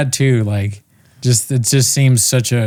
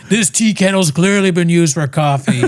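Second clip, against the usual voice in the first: wife-berating husband, higher and sneering; mock abusive husband; 6 semitones higher than usual. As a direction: spit it at the wife like a petty tyrant. Voicing abusive husband Tom.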